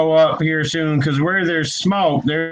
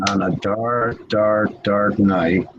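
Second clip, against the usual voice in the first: spooky storyteller; 7 semitones lower, slower than usual. Acted.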